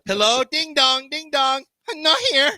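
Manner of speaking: high sing-song doorbell and reply; mock doorstep call. Visitor rings as resident hides, mock doorstep call. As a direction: call out high, playing both sides.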